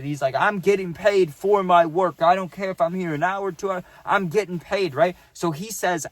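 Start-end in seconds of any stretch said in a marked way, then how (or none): 0.3-4.9 higher, insistent mock rant; imitated cussing out. Santa insists to the manager on pay, imitated angry rant. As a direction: dig in stubbornly, acting out his anger. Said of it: "Santa starts cussing out the manager".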